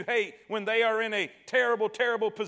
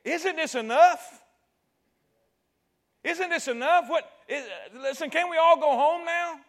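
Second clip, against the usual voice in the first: higher, louder desperate plea; pleading Pilate; 7 semitones higher, 5 dB louder than usual. Retold or imitated. Pilate begging the mob to stop, raised plea. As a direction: beg the crowd to let it end. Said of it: "he's trying to say".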